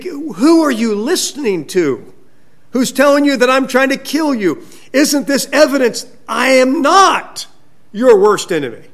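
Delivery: high, loud pleading challenge; impassioned protest. Retold or imitated. David protests his innocence to Saul, loud and impassioned.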